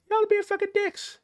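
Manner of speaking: very high exasperated squeal; high-pitched exasperated outburst. Real, not acted. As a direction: pitch flies up in disbelief.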